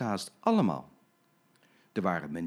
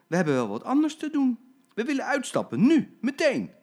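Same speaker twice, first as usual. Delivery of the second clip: much higher, louder, building fury; angry demand; 13 semitones higher, 7 dB louder than usual. Acted. Passengers angrily demanding to get off, building. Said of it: "stonden allemaal boos op en zeiden".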